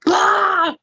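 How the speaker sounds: loud, high mock roar; angry "blah" outburst. Wife acting out her anger at husband, loud outburst. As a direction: blurt out a cartoonish burst of anger.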